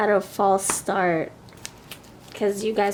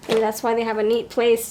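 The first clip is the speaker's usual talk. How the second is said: ordinary delivery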